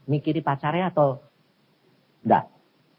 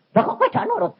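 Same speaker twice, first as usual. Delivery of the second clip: angry prophet; 8 semitones higher, faster than usual. Retold or imitated. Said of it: "Nabi ini marah".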